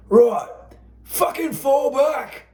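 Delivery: high, slow, loud shout to fall back; shouted order. Acted. Sergeant yelling at his men to retreat, high slow shout. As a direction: bellow the retreat across the field.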